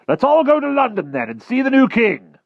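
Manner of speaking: high, loud rallying call; excited. A narrator's reading. Sir Ector urging his guests to London, loud and excited. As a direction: rouse the whole table to go.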